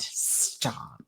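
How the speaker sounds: a scream retold in a low whisper; voiceless, underplayed scream; hushed retold scream. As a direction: whisper the remembered cry, soft and drawn back. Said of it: "And I just screamed".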